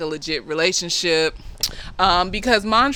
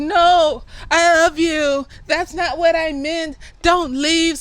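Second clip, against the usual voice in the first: retold begging, pitched way up; desperate pleading; 10 semitones higher, slower than usual. Montrose calls after Sammy as he walks out, pleading. Acted plea to departing lover.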